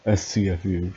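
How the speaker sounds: usual speaking voice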